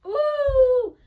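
a sudden high, loud, delighted squeal; excited squeal. Real, not acted. Delighted at finding the shorts.

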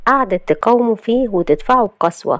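usual speaking voice

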